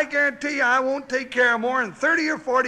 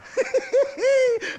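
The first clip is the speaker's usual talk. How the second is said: hearty laugh; 11 semitones higher than usual. Real, not acted. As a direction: laugh at the catch. [name] laughs at the joke.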